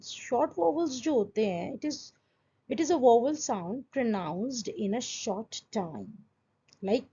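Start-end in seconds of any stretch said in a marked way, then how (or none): none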